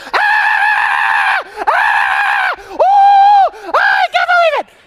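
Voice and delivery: high, loud squealing delight; excited shrieks. Retold or imitated. Young women scream over a friend's pregnancy news, squealing with delight. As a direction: squeal like you just heard the best news.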